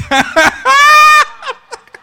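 high, loud cackle; shrieking laugh of triumph; gloating laugh. Acted. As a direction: laugh long and high in their face. Gloating laugh after the quote.

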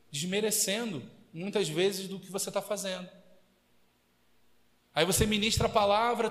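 ordinary talk, nothing marked

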